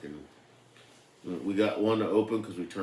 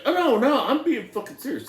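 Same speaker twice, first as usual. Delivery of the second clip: laughing; 9 dB louder than usual. Retold or imitated. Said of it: "And the dude's laughing".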